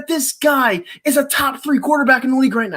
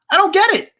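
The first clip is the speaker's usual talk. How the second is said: furious; 7 semitones higher, 4 dB louder than usual. Real, not acted. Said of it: "That's what makes me furious".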